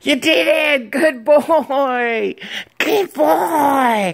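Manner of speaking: high excited dog praise; squeaky praising a dog. Real, not acted. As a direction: gush over the dog in a high voice. Owner praises dog after success.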